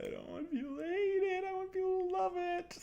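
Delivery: very high squeaky plea. Pretend. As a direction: squeak it like a cartoon. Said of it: "repeated line".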